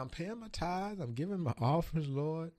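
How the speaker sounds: pleading with the Lord, pitch climbing; rising plea to God. Retold or imitated. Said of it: "I said".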